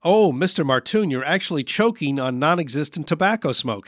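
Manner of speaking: usual speaking voice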